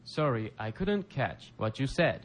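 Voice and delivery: slow, careful teacher model line; slow model read. Shown as a demonstration. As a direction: say it slowly for learners to repeat. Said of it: "皆さんも一緒にリピートしてください".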